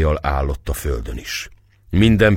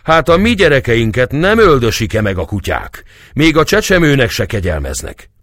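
loud, high-pitched indignant protest; raised voice, bitterly indignant; outraged shouting; 8 semitones higher, 5 dB louder than usual. Acted. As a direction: hurl it at the crowd in anger. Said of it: "ordította Bódogfalvi a kardját kirántva".

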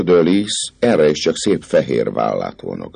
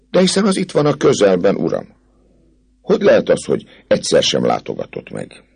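put-on higher, reproachful girl's voice; reproachful young lady; 7 semitones higher than usual. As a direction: scold him gently, hurt. Fleur de Lis reproaches captain. (szemrehányó, magasabb lányhang)

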